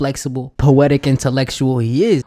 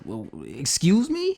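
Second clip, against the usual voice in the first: offended retort; 3 semitones higher, 4 dB quieter than usual. Retold or imitated. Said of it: "She says".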